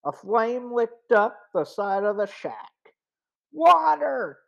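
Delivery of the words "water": urgent shout for water; yelled. A narrator's reading. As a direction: yell it out in alarm. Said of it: "Joe yelled".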